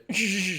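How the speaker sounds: hissing breath demo of punching sounds; boxer's punch exhale. Shown as a demonstration. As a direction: push air out in a boxer's hiss. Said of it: "a lot of people do a lot of this".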